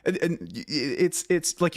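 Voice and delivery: ordinary talk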